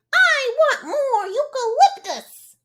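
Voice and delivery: very high, loud screechy koala; koala screech. Acted. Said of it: "you'd screech and cause quite a fuss".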